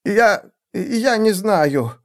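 high, slow, halting admission; fearful stammer. A narrator's reading. Assassin admits ignorance to seer, fearful.

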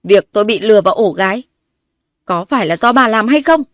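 loud, rising accusation; angry demand. A narrator's reading. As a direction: demand the truth. Heroine demanding answer again.

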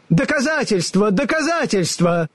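high, pressing demand; insistent. A narrator's reading. The wizard insistently demands proof. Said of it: "настойчиво сказал голос".

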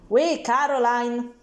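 loud, slow call from upstairs; old man hollering a greeting down the stairs; shouting. A narrator's reading. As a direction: call out to her through the fog. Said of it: "gridò il vecchio pazzo del piano di sopra".

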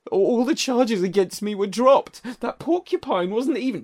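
high, wailing blubber; a mock blubbering plea. A narrator's reading. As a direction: plead it out high and teary. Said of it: "Joel blubbered".

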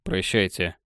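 low, understated curt goodbye. A narrator's reading. Mark leaving without looking at anyone, curt. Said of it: "коротко сказал".

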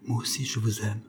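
very soft, quick confession; hurried faint stammer. A narrator's reading. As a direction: let it slip out almost under the breath. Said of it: "elle balbutia très vite". (aveu balbutié tout bas)

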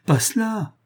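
high-pitched, frantic begging; horrified. A narrator's reading. Lover begs to be spared the meeting, horrified. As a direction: implore in dread, pitch leaping up. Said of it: "dit Julien épouvanté".

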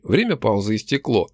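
plain reading voice

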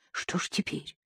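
frightened inner whisper; whispered thought. Acted. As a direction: breathe the thought, no voice. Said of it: "пронеслось у неё в голове".

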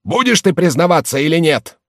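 high, loud angry shout; worked-up bullying question; furious demand. Acted. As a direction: yell the threat at him. Beats him, demands a confession.

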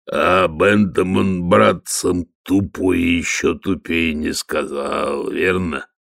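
lightly voiced lumbering troll. Troll Detritus mocks messenger.

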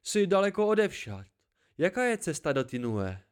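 a lively hermit delighted to see company; the old listener welcoming a weary traveller; cheerful old hermit. A narrator's reading. Old man greets Jax.

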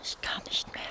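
weak, unvoiced whisper; exhausted whisper. Worn-out woman in the sewer, exhausted whisper. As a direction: barely breathe out the words.

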